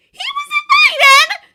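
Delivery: very high, loud cry of dismay; indignant outburst. A narrator's reading. Glinda protesting at the dinner table, loud and high. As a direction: burst out shrill and hurt.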